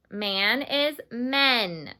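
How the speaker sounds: "Man is men" said slowly, each word apart; slow model. Shown as a demonstration. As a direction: spell it out slowly for the class. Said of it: "Repeat that after me".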